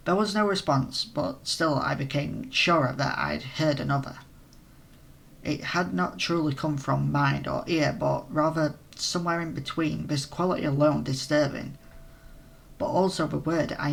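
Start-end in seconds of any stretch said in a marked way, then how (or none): none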